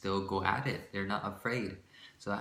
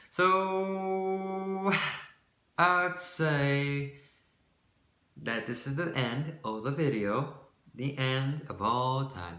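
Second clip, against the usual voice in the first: higher, louder grand announcer voice; dramatic announcer; 6 semitones higher, 5 dB louder, much slower than usual. Pretend. Ending the vlog to viewers in a grand announcer voice. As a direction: boom it out like a movie trailer ending.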